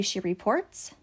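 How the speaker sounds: ordinary delivery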